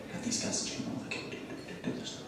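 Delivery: whispered under the breath, as if hiding it from the client on the phone; a quick unvoiced aside to himself. Muttering to himself mid-call.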